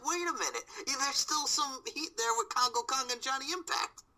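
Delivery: high, excited rant; excited realization. Real, not acted. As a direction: pitch up as it dawns on you. Excited rant about feud.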